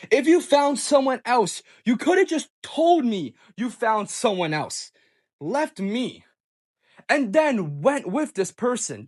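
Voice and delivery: hurt reproach. Retold or imitated. Boyfriend reproaches girlfriend who found someone else, indignant.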